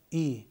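ای said as a long ee vowel, set against ا; kasra sound i. Shown as a demonstration. Teacher contrasting fatha and kasra on alef. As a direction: stretch ای into a clear ee.

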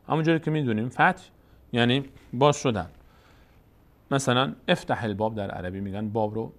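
normal talking voice

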